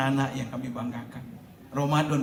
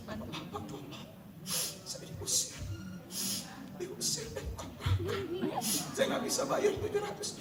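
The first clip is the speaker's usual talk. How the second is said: whispered, then high tearful whimper; imitating sobbing plea; 11 dB quieter than usual. Weeping mother begging the ustadz for rent money, high tearful whimper. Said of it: "nangis sesenggukan".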